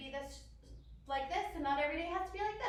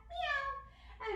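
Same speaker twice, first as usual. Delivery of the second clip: high little meow; cat meow; 13 semitones higher than usual. Shown as a demonstration. Teacher cueing children into cat pose, high cat meow. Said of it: "arch your back for cat".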